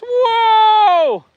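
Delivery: sudden high shouted whoa; startled whoa. Real, not acted. Farmer startled mid-chat, shouting whoa. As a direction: jolt in shock.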